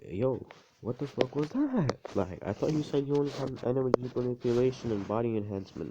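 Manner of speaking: high-pitched imitation of baffled disbelief; incredulous outburst. All Might questioning Izuku about teleporting, mock incredulous.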